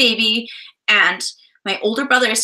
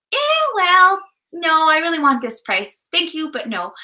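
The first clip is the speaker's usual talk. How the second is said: high-pitched, mock-polite turndown; light, high imitation of an easygoing refusal; 6 semitones higher than usual. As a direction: imitate someone declining nicely, pitched up. Imitating polite Calgary sellers declining.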